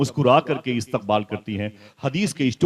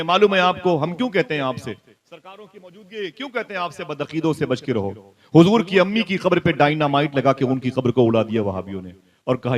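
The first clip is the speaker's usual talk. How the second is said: ordinary talk, nothing marked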